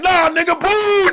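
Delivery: high, loud mock crowd boo. Crowd booing the comic again, loud high mock shout. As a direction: shout the boo like a rowdy room. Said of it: "They was like".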